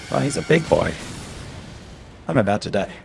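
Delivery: ordinary talk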